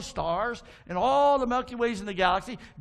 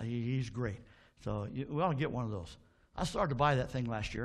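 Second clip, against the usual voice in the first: ordinary delivery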